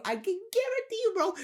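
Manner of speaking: high-pitched excited guarantee; excited squeak. Real, not acted. Coach hyping his tip to viewers, excited squeak. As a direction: leap up very high in sudden insistence.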